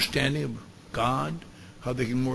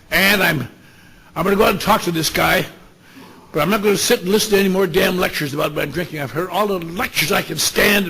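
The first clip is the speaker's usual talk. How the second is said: loud, higher, fed-up rant; irritated tirade; 4 semitones higher, 8 dB louder than usual. Retold or imitated. Drinker tells his wife he'll allow ten minutes, irritated. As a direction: hammer it out louder, at a pushed pitch.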